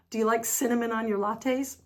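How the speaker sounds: ordinary talk, nothing marked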